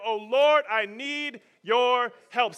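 slow, loud plea to the Lord; pleading prayer. Shown as a demonstration. Preacher modelling a prayer for the crowd, pleading. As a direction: cry out for help, dragging it. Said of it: "Someone say that".

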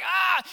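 high pained yelp, imitated; mock yelp from a sore neck. Husband yelps as wife rubs his neck, high mock cry. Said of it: "And so I'm like".

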